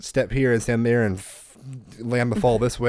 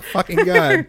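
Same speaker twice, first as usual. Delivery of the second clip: mock disgust; 6 dB louder, slower than usual. Mock disgusted reaction imitated.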